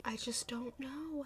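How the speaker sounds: hushed, helpless whisper. Real, not acted. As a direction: whisper it to yourself. Helpless about the repost.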